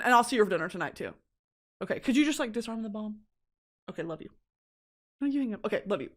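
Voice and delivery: usual speaking voice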